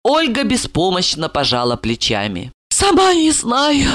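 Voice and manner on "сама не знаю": high, drawn-out helpless woman; tipsy helplessness, slow; heavy sighing. A narrator's reading. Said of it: "тяжело вздохнула она".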